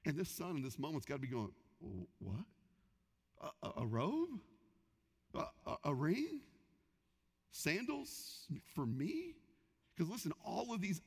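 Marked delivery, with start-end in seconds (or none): 1.8-9.3 halting, hushed and unbelieving; stunned disbelief. Acted. Forgiven son hearing of robe, ring, sandals, hushed stammering disbelief. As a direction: stammer like you can't believe it.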